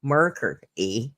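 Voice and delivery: marker drawn out Canadian style, then a low eh; mock Canadian marker, eh; Canadian accent. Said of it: "too Canadian".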